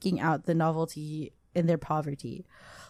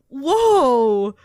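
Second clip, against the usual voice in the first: excited; 8 semitones higher, 6 dB louder, much slower than usual. Real, not acted. Reacting to listener's story submission.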